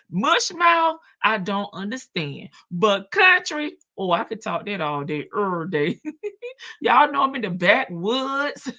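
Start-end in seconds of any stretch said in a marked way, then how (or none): none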